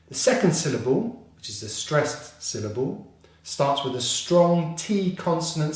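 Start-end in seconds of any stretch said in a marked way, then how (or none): none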